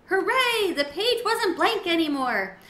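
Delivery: high, loud hooray; joyful cheer. A narrator's reading. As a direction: cheer the little victory. Hamster celebrates success.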